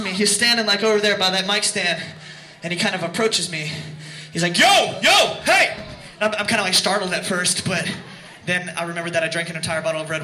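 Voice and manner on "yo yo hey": high, loud street holler; acted shout of a stranger hailing someone; calling out. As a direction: call out sharply to get a passerby's attention. Stranger calls out to narrator.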